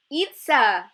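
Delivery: loud, high call across the field. A narrator's reading. Violeta calling to her friend across the field, loud call. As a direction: shout her name to get her attention. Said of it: "someone called out".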